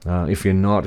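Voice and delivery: ordinary talk, nothing marked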